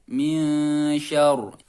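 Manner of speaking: slow "min syarr" with a thick, heavy "syarr". Shown as a demonstration. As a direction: make the ro in "syarr" full and heavy. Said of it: "Jadi roknya harus tebal". ("syarr" dibaca dengan ro tebal)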